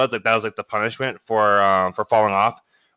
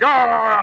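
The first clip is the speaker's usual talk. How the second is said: short high yell, louder; war cry scream; 9 semitones higher, 5 dB louder than usual. Shown as a demonstration. Showing a boot camp war cry, loud yell. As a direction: belt out a Braveheart war cry. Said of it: "like, Braveheart".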